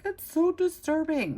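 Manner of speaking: high-pitched imitation of dismayed parents; parents' mock dismay. Retelling parents' reaction to a disturbing show, mock dismay. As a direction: mimic parents appalled, pitch jumped way up.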